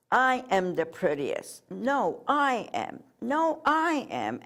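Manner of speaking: lightly acted shouting match, rising in pitch; mock shouted squabble. A narrator's reading. Crayons fighting over who is prettiest, mock shouting. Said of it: "they were fighting and shouting".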